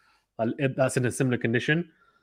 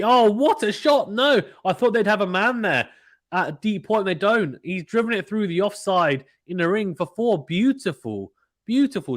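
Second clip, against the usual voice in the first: excited; 7 semitones higher than usual. Real, not acted. Live commentator cheering a boundary, excited and high. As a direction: let the big shot lift your voice up high.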